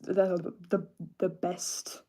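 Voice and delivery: strained, breathy bottom of the range; strained low voice. Shown as a demonstration. Showing viewers how strained the deepest range sounds. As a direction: let the voice sag low and strained. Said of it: "As you can hear, it's, like, not the best".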